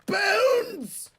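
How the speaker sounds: high, loud cry of delight; excited squeal. Real, not acted. Delighted shock at skin.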